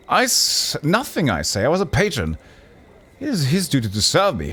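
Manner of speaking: high, eager pleading courtier voice; fussy noble. Acted. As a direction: rush up and beg for attention, pitched high.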